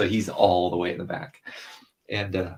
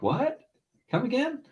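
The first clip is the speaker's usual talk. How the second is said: high mock "What? Come again?"; teasing dad. Dad teasing his son in the van, high mock question. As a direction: pretend not to hear, teasing. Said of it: "I'll be like".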